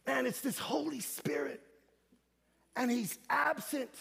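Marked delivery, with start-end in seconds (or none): none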